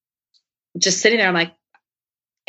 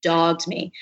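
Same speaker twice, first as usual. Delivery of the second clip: ordinary talk, nothing marked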